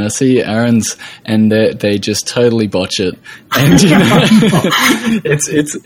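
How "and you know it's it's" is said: higher, amused, laughter breaking into the words; laughing while talking. Real, not acted. Host recalling a friend's botched coffee orders, laughing. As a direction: talk through real chuckles.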